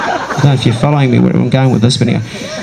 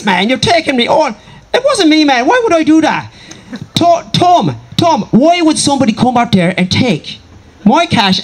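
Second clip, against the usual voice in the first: high-pitched, outraged Irish squabble; hammed-up Irish row at a high pitch; arguing Irishmen; 8 semitones higher than usual. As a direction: act out mates accusing each other, pitched way up. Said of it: "'cause I do this like it was".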